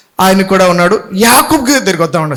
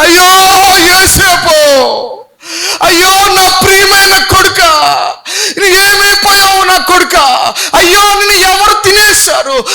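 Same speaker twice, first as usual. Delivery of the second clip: high, loud wailing cry for a lost son; Jacob's lament; 10 semitones higher, 4 dB louder, slower than usual. Acted. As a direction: wail it out like a grieving father. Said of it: "అని బాధపడుతున్నాడు".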